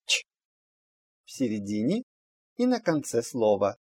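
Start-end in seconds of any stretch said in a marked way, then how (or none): 0.1-0.2 "ч" as a voiceless affricate, no vowel; pronounced "ч". Shown as a demonstration. Teacher showing the letter's other sound, pronounced as bare "ч". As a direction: make a short isolated "ч" sound. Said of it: "иногда произносится".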